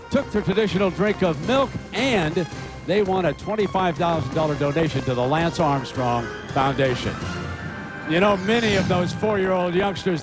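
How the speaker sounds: ordinary talk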